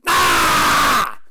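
loud, low release shout; yell. Shown as a demonstration. Coach yelling with viewers in the shift move, a loud, low shout. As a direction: let out one big, deep yell. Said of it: "Then we're gonna yell".

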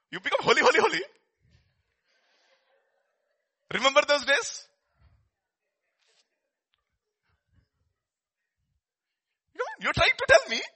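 high, loud mocking sing-song; mocking taunt. Old friends mocking the new pastor, a high, loud, sneering taunt. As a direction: mimic scornful old friends teasing you. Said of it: "That's what they will say".

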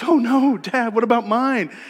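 mock alarm, piping up from the back seat; voicing young daughter. Nine-year-old asks dad about her college money, lightly voiced. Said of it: "and the nine-year-old goes".

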